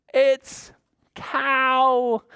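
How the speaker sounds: very high, loud happy announcement; spring the surprise on little kids; excited reveal. Pretend. Storyteller revealing the duck to children, excited reveal.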